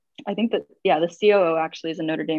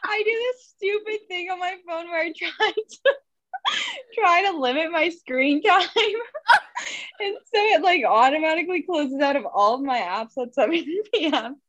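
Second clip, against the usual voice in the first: much higher, giggling through the explanation; laughing; 9 semitones higher than usual. Real, not acted. Risa explaining to the host why her screen closed, laughing. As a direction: explain while laughing at yourself.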